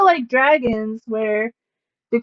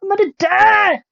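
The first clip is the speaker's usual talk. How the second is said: high, drawn-out mock panic cry; imitated gaming panic; 8 semitones higher, 4 dB louder than usual. Gamer imitating self playing games like this, mock panic. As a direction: wail in exaggerated panic after quick gasps. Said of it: "when I'm playing games like this, I'm like".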